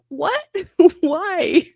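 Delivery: high, incredulous what and why; mock shock. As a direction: react like you can't believe it. Shocked reply to the attending.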